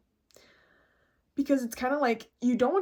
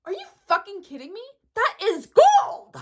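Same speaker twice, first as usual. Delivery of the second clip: high disbelief building to a loud cry; astonished, hyped-up exclamation; amazed outburst; 9 semitones higher, 8 dB louder than usual. Real, not acted. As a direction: draw out the last word, loud and high. Amazed by the advice.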